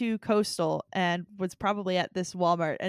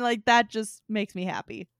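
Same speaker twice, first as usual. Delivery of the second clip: ordinary delivery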